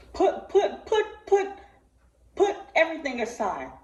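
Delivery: high, loud pleading put put; emotional plea. Real, not acted. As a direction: urge them to stop and listen. Pleading with the audience.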